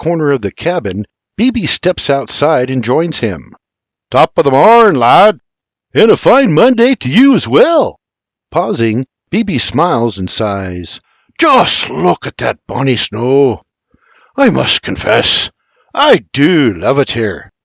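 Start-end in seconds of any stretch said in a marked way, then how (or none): 4.1-5.4 hearty Scottish greeting, loud; Scottish brogue. Acted. Old Scot greets William. 5.9-8.0 a lightly voiced young Irishman, higher; cheery answer, pitched up; cheerful reply. 11.4-17.5 lightly voiced contented old Scot; Scottish brogue. Old Scot admires snow.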